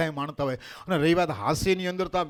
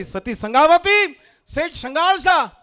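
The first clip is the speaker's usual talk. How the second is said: loud, high, drawn-out hail; resounding call; 10 semitones higher, 7 dB louder, slower than usual. Acted. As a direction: call out the names slowly and grandly. God hails the devoted couple.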